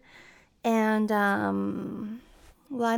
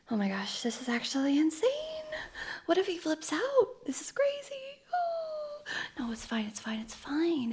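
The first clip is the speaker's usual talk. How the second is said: nervous whisper; 6 semitones higher, 5 dB quieter than usual. Acted. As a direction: panicky whisper to herself. Alone, nervous before reveal.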